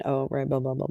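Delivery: normal talking voice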